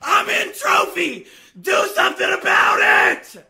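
loud, high, slow challenge; shouted taunt. Real, not acted. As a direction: bellow a dare at your rival. Calling out the opponent in game.

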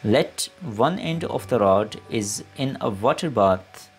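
usual speaking voice

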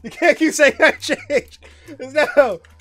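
high, loud, laughing protest; wheezing with laughter, slow and loud; real helpless laughter. Real, not acted. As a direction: beg them to stop while cracking up. Cracks up at donation message.

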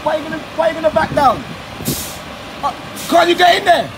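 high, loud and fast in the middle; shouting at drivers. Real, not acted. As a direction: bark it at the blocking driver. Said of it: "my voice is going, 'cause I'm shouting".

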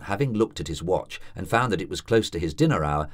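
usual speaking voice

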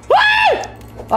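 very high squealed "woo"; short falsetto whoop; high-pitched whoop. Real, not acted. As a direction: let out a shrill-pitched yelp. Freezer scare, yelps.

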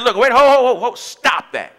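loud, sharp interruption; God cutting in. Retold or imitated. A preacher voicing God halting a complaint, loud. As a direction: cut him off mid-complaint. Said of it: "God said".

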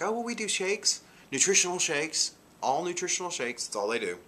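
high chirpy mimic of the shop girl; lightly voiced perky salesgirl; imitating the shop girl.

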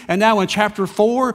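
usual speaking voice

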